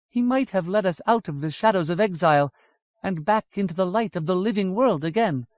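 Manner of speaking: lightly voiced solemn elf lady; sorrowful elf woman. Aditu tells of the Storm King.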